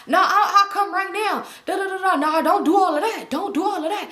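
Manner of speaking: mock his indignant dismissal; retold trash talk. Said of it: "he talking shit".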